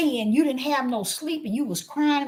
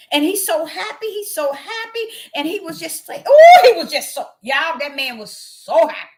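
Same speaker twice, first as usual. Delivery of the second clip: mock excitement; 6 semitones higher, 9 dB louder than usual. Mocking the man's joy.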